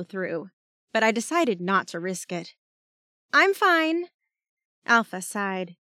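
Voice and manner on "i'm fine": called out. Acted. Calling out through door.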